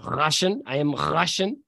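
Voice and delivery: "Russian" started far back in the throat; throaty Russian R. Shown as a demonstration. Mercenary demonstrating "Russian", its first sound made back in the throat. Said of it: "it's in the back of the throat".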